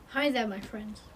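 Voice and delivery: low, barely audible greeting; hushed, low-pitched hello; very quiet. Real, not acted. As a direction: say it so quietly nobody can hear. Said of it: "You say that so quietly".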